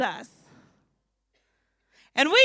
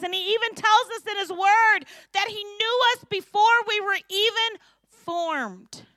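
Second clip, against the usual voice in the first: ordinary talk, nothing marked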